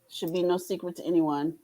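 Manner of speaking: normal talking voice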